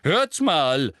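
loud, high-pitched indignation; angry Lockhart. A narrator's reading. Lockhart interrupting a long silence over his handwriting, loud and angry. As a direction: protest crossly, rising to an insistent question. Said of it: "von Lockharts zorniger Stimme".

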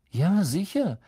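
hoch angesetzte, lockere Antwort; beiläufig abwinken; lässig. A narrator's reading. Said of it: "sagte Harry lässig". (lässig)

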